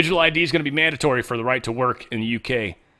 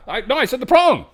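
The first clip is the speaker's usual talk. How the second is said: high, louder defensive protest; flustered denial; 14 semitones higher, 7 dB louder than usual. Pretend. The speaker defends himself against China, high and flustered. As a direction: backpedal fast and high.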